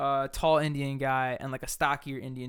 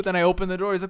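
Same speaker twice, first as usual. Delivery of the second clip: ordinary talk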